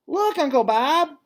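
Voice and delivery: put-on a delighted kid showing off; little Ruby. Child elephant Ruby playing.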